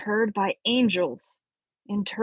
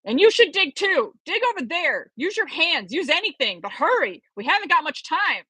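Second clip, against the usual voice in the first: higher, urgent orders to dig; urgent call; 7 semitones higher than usual. Acted. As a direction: push him to hurry. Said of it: "the professor yelled over his shoulder".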